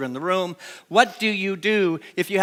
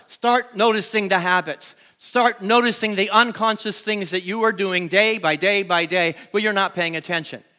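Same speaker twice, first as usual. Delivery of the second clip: ordinary talk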